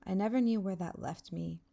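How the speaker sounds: ordinary delivery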